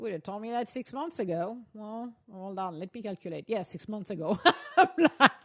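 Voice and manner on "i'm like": words tipping into a real laugh; amused laughter. Real, not acted. Host joking to audience about calculating, amused laughter. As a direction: laugh after finishing the sum.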